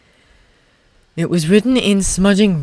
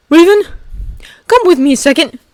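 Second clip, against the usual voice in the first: urgent instruction; 11 semitones higher, 5 dB louder than usual. Acted. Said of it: "he instructed".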